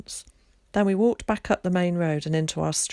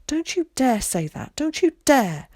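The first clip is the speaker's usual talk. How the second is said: high, loud stern threat; mother warning her daughter; furious warning; 8 semitones higher, 4 dB louder than usual. A narrator's reading. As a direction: stop her dead.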